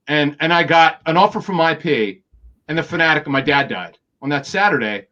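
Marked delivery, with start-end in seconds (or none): none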